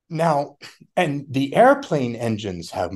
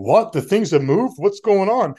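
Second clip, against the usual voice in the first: pitched-up, louder mock panic; mock freak-out; 6 semitones higher, 5 dB louder than usual. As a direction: play a pilot suddenly panicking, half in jest. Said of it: "freaking out and going".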